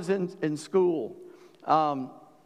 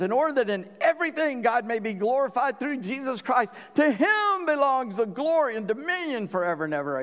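raised, rising praise of a man carried away; excited praise; 8 semitones higher than usual. Retold or imitated. Peter bursting into praise, excited and raised. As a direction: let Peter's joy lift the verse up high. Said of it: "Peter got all excited".

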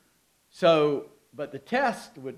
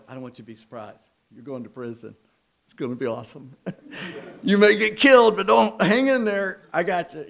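mock Jesus pep talk; 3 semitones higher, 9 dB louder than usual. Jesus cheering on disciples bound for prison, hammed-up pep talk. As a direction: play Jesus as a breezy coach cheering them on. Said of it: "I'm kinda making fun to make the point".

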